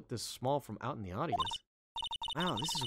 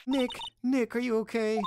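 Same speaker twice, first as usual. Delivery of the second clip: higher and louder worried shout; alarmed call; 11 semitones higher, 6 dB louder than usual. Acted. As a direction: call out to him in fear. Calling to hurt friend.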